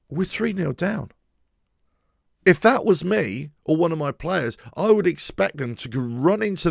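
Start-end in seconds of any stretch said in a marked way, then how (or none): 0.1-1.1 higher, offhand mock player voice; mock shrug. Pundit mocking a smiling player, mock shrug. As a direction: shrug it off like it doesn't matter.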